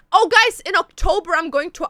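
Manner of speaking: high, loud burst of excitement; excited announcement. Real, not acted. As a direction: burst out with news you just remembered. Suddenly remembers exciting party news.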